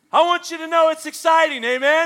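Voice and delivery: high, loud preacher's cry; full-force shout. Real, not acted. Preacher shouting to the congregation, high and loud. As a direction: shout the joy out to them. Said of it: "preaching at full force".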